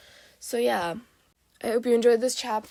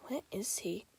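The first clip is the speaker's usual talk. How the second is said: whispering; 11 dB quieter than usual. Acted. Searching dark alley quietly.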